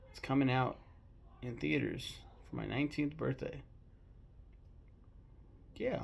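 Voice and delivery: ordinary delivery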